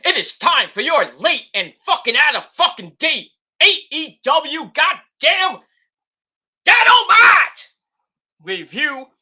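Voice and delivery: rising, loud, drawn-out ring-announcer build to a shout on Dynamite; hype announcer. Acted. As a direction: build it like a wrestling announcer to a huge roar. Announcing the show, hyped.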